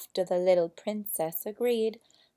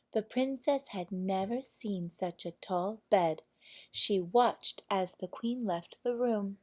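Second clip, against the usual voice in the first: ordinary delivery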